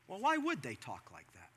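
a patient father nudging them to think; calm dad. Retold or imitated.

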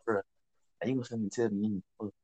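low, hushed, quick murmur; whispering. Retold or imitated. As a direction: keep it hushed between buddies. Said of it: "we be whispering".

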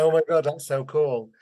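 lightly voiced excited schoolkids; a hint of childlike amazement; imitated impressed kids. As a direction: react like kids who are impressed. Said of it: "And they're like".

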